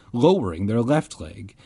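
usual speaking voice

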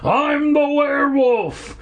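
high, drawn-out manic shriek; crazed shriek. Acted. Hermit chasing the kids, high manic shriek. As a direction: shriek it like a madman. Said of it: "the hermit shrieked".

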